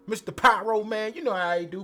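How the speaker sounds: normal talking voice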